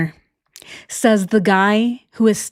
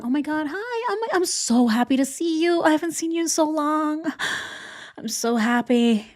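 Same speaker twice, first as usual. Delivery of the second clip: mock gushing greeting; 8 semitones higher than usual. Mock greeting a loved one.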